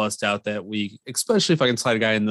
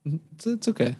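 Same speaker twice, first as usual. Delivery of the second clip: mock-disappointed, higher and softer; imitated disappointment; 6 semitones higher, 5 dB quieter, faster than usual. Teasing the co-host about his weekly reassurance, mock disappointed. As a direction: do a small, let-down mimicry of a friend. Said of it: "you sound so disappointed".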